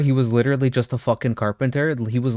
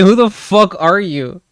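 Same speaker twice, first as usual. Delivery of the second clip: higher and louder, a mock yell of disbelief; incredulous yell; 6 semitones higher, 6 dB louder than usual. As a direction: hurl the question at a stranger in fake outrage. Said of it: "I'd be like".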